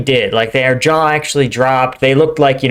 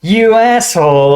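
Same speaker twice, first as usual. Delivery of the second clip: "You asshole" thrown out higher, louder and slower, actually angry; really mad; 7 semitones higher, 4 dB louder than usual. Shown as a demonstration. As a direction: spit out "You asshole" at someone you're furious with. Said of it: "you are really mad at someone".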